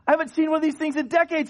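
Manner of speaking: high, loud, fast rush of delight; excited gush. Retold or imitated. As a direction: rattle it off amazed. Thrilled at seeing old car.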